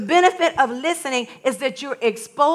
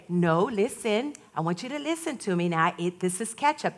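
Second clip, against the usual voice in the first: calm patient mother; 4 semitones lower, 9 dB quieter than usual. Retold or imitated. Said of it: "And she would say".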